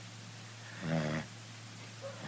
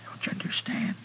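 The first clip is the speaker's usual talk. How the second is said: whispering; faster than usual. Acted. Dying man pleading.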